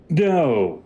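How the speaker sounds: high, loud falling "no"; pompous wizard cut short; deflated showman wizard. Acted. Wizard stops short, answers.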